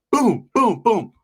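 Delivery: loud mock punch noises, boom boom; hammed-up fight sound effects. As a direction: smack out each hit like a blow landing. Imitating punches on the fence.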